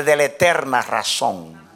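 ordinary talk, nothing marked

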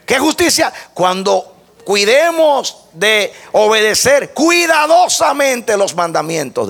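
loud, high scripture declamation; ringing, emphatic preacher's delivery; preaching at full force. Real, not acted. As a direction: proclaim the verse with rising fervor, stretching key words. Declaims a scripture verse and restates it emphatically.

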